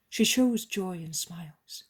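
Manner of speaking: usual speaking voice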